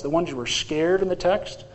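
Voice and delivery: ordinary delivery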